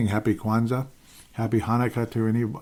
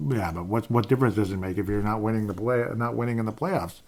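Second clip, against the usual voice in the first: normal talking voice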